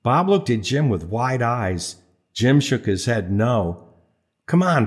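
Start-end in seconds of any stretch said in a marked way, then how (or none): 4.5-4.9 higher-pitched, coaxing plea; wheedling. A narrator's reading. Bob works on his reluctant friend, mild wheedling. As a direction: coax your friend into giving in. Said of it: "Bob wheedled".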